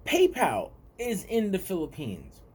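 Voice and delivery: normal talking voice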